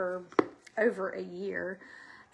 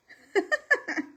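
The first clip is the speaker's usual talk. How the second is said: short high giggle; high laugh; 12 semitones higher, 6 dB louder than usual. Real, not acted. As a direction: laugh at yourself. Laughs at own granny remark.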